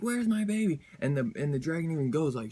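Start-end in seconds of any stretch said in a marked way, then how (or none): 0.0-0.8 panicked. Retold or imitated. Jubilee still searching for her baby.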